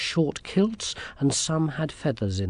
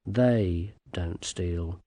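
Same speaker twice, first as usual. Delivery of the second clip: THEY drawn out slow and low; meaningful stress on THEY; 6 semitones lower, much slower than usual. A narrator's reading. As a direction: lean slowly on THEY, hinting at others. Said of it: "something in the way the Toad said it".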